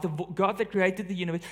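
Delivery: ordinary delivery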